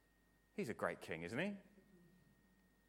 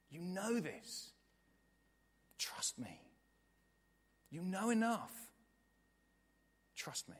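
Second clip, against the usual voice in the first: slow, high urging, dropping to a hush; tender, drawn-out appeal with long pauses; pleading reassurance; 6 semitones higher, slower than usual. Acted. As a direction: coax slowly, alternating earnest lift with near-whisper. Acted Jesus pleading with listener.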